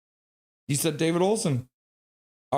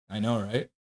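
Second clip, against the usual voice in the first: normal talking voice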